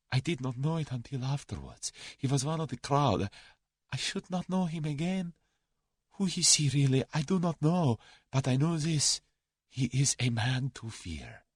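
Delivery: high-pitched, awed Russian account; fearful Russian. A narrator's reading. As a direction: confide your dread of the man.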